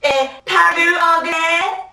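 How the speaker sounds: slow, high-pitched mocking call; accent critic. Critic of her French accent demanding English, loud and drawn-out. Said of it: "subtilement critiquer mon accent français".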